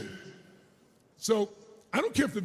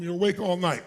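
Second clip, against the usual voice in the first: ordinary delivery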